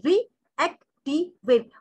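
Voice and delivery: Reactivate broken into four slow, separate syllables. Shown as a demonstration. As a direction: pause between each syllable of reactivate. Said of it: "चार हिज्जे किए हमने इसके".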